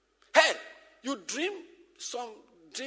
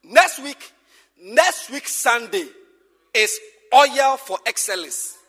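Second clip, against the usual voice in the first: louder and slower, each word weighed; emphatic announcement; 13 dB louder, slower than usual. Real, not acted. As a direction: proclaim it to the whole hall.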